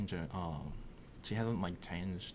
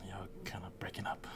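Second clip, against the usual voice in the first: soft, breath-only whisper; 11 dB quieter than usual. Pretend. Brother asks for a repeat of what was whispered, whisper. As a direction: whisper back confidentially. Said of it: "your whispering didn't pick it up".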